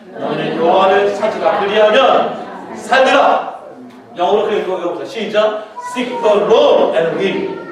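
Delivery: very slow, loud, spaced-out recitation; loud slow unison memory verse; scripture recitation. Acted. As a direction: drill the verse with the church. Congregational recitation.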